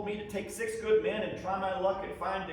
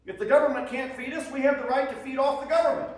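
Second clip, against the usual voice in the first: louder, higher and faster, fired up with indignation; defiant outburst; 6 semitones higher, 6 dB louder, faster than usual. Acted. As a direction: spit out the grievance in a rising rush. Soldier's defiant complaint about rations.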